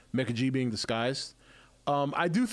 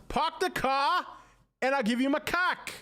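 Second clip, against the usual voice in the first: high hammed-up Boston "Park the car" with no r; over-the-top Boston accent, higher; 12 semitones higher than usual. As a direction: classic Boston joke, broad and pitched up. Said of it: "That's how they talk".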